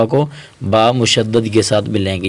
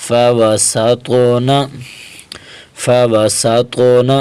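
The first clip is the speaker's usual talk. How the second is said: ordinary talk, nothing marked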